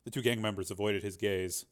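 ordinary delivery

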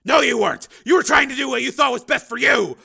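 angry shout. Acted. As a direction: Steele throwing her selfishness back at her, loud angry shout. Steele accusing ex-wife.

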